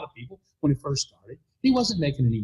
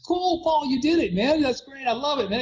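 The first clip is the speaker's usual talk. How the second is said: excited praise; 9 semitones higher than usual. Retold or imitated. Praising Paul's new Cadillac.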